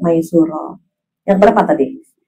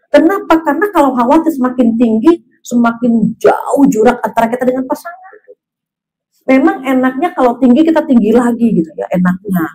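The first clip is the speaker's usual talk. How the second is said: ordinary delivery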